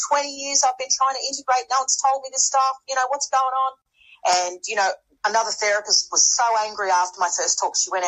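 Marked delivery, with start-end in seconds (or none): 0.1-3.7 exasperated. Retold or imitated. Quoting frustrated attendees.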